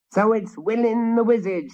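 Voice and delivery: high, shrill hag voice; hideous witch cackling at the wizard. A narrator's reading. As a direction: shriek it like a witch. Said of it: "she shrilled".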